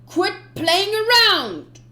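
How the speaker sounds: slow, loud and high yell; furious shout. Acted. Captain screaming at his commander, furious. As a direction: scream at him to stop stalling.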